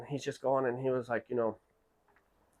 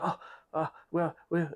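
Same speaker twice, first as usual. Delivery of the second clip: nervous stammer; 6 semitones higher than usual. Retold or imitated. Employees caught out, stammering.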